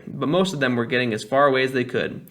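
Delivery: ordinary delivery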